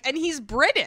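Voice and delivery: imitating your own impressed introduction; mock grand announcement. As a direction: announce it like a big deal. Repeats own habitual announcement.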